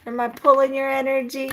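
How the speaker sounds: giddy high-pitched teasing; playful teasing. Real, not acted. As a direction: tease them in a high playful voice. Playfully teasing an unseen presence.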